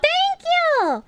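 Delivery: squeaky thanks. Real, not acted.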